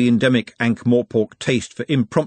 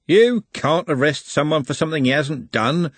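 lightly voiced bystander, higher pitched; opinionated passenger; 5 semitones higher than usual. Bus passenger nodding sagely, opinionated. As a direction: lay down the law as an onlooker.

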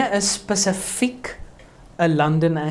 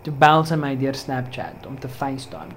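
ordinary talk

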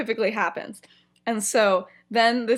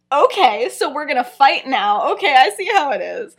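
louder, mildly indignant; mock combative; 3 semitones higher, 7 dB louder than usual. Lily answers James storming upstairs, mock combative. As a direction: take up the challenge, voice firmed up.

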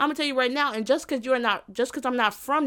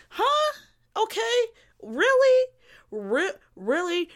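mock amazement; 9 semitones higher, slower than usual. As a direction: react as if utterly baffled, voice shooting up. Feigned amazement at someone's boast.

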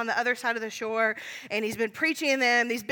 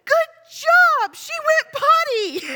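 put-on high, booming child's cheer, drawn out; proud toddler praise at full volume; really loud kid; 8 dB louder, slower than usual. Kid cheers a stranger in a public bathroom, very loudly. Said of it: "one of my kids really loudly goes".

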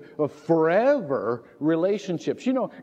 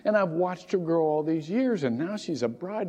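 usual speaking voice

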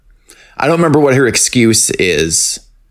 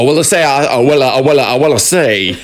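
Foghorn Leghorn try; 3 semitones higher, faster than usual. Pretend. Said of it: "me trying to do a foghorn leghorn".

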